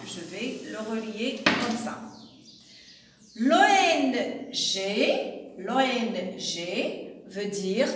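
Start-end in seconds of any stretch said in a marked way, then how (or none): 3.4-7.2 letters O N G spaced out slowly; slow, careful classroom dictation; slow spelling. Shown as a demonstration. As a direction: dictate the acronym slowly to pupils. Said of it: "teacher spells the acronym".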